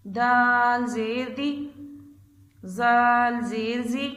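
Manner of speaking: put-on slow, measured letter-by-letter chant for children; drawn-out classroom recitation of Arabic letters; slow qaida recitation. Shown as a demonstration. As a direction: spell each letter out slowly and clearly. Second slow run through the letters.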